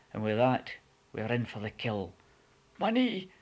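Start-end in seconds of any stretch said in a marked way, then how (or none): 2.8-3.3 high pained yelp; high, loud cry of pain over his knee. A narrator's reading. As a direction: yelp it like a hurt man. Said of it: "he cried".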